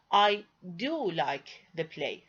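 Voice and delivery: slow, with "do" drawn out and stressed; emphatic. Shown as a demonstration. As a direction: lean hard on "do" before the verb. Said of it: "I want to emphasize the verb like".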